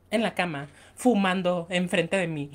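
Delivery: normal talking voice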